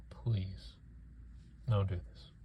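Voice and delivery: a last hushed plea; whispered plea. Real, not acted. Begging director Sean Levy not to ruin Deadpool, whispered. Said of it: "I'm begging you".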